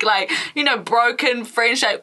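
ordinary delivery

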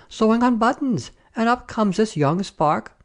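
high, aggrieved complaint; indignant soldier. A narrator's reading. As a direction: protest like a wronged soldier. Soldiers' grievance, retold.

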